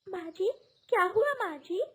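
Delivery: high, jolted awake and alarmed; startled. Acted. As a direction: gasp it out, suddenly woken. Woken by water splash.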